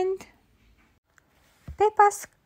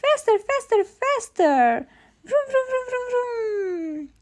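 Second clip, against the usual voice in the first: high, urgent chant of faster and vroom; excited cheering; 9 semitones higher than usual. A narrator's reading. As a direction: cheer the racer on, wildly. Peppa cheering George's race.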